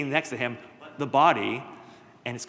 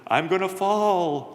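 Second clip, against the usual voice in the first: slow, drawn-out, higher ghostly moan; spooky ghost call; 6 semitones higher, 4 dB louder, much slower than usual. Acted. Ghost in the fireplace calls out to Jack, slow ghostly moan. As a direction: stretch each word out like a ghost calling from the chimney.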